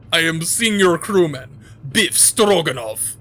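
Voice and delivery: slow loud mock Russian growl; Russian accent. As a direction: drag it out in a hammy Russian voice. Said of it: "what that's a play on".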